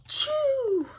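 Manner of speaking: sharp high acted sneeze; sudden hammed-up sneeze. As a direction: sneeze cutting off the word. Gerald sneezes mid-sentence.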